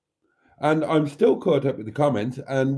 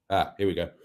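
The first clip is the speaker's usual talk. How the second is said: usual speaking voice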